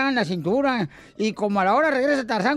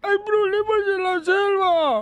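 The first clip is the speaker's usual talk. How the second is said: high, hoarse voiceless cry of a tiger; 7 semitones higher, slower than usual. Acted. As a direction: croak it out like a tiger with no voice left. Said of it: "Ya fónico". (tigre afónico gritando)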